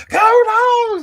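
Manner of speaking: high, loud, drawn-out holler; full-throated rallying shout; excited shout. Retold or imitated. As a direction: yell it out the car window to a fellow fan. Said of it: "shouting at each other".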